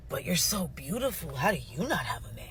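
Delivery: soft, swooping mock disbelief; gushing retold amazement, kept soft; surprised. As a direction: imitate a stunned admirer, quietly incredulous. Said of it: "They be surprised".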